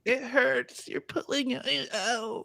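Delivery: high, soft, slow whimpering complaint; whiny Becky voice. Retold or imitated. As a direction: whine like a pampered tourist. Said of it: "Becky's gonna squirm".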